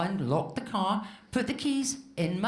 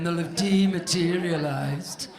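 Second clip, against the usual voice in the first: Steve impression; 4 semitones lower, slower than usual. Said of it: "Fucking Steve wasn't being any help".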